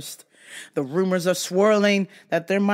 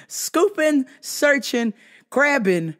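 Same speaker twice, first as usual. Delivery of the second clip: ordinary talk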